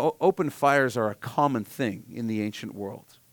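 ordinary delivery

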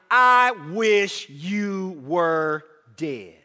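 very slow, low, cold line; slow cold wish. Acted. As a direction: let each word fall slowly and coldly. Son's hidden meaning voiced.

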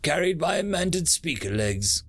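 cyborg guard voice. Acted. Cyborg Dimitri's announcement.

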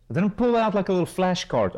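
normal talking voice

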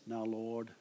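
a hammed-up pious voice dropping into prayer; deep prayer voice. Mocking a friend who suddenly turns solemn to pray. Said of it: "their voice deepens".

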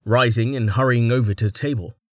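plain reading voice

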